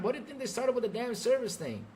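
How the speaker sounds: usual speaking voice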